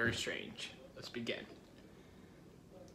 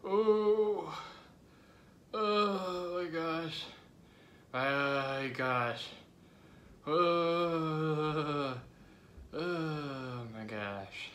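pained groaning; 4 semitones higher, much slower than usual. Real, not acted. Hot pepper pain reaction.

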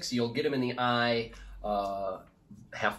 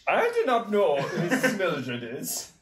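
laughing; 6 semitones higher, 4 dB louder, slower than usual. Real, not acted. Denying he knows Mildred.

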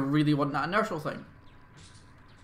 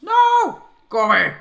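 high, loud frantic shout; panicked yell; 14 semitones higher, 14 dB louder, slower than usual. Real, not acted. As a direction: scream them away. Zombies closing in.

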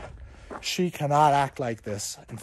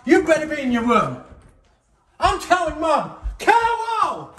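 loud, high calls through the house; dad calling Carol in a fury; angry shouting; 12 semitones higher, 4 dB louder than usual. Acted. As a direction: bellow for the wife. Dad yells for kid and wife.

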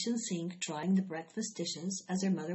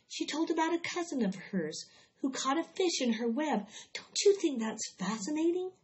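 lightly voiced girl, pitched up with wonder; a hint of an excited little girl sharing news; eager Fern; 7 semitones higher than usual. A narrator's reading. Fern telling her mother about Charlotte's cousin, eager.

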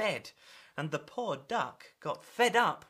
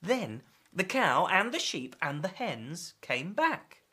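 plain reading voice

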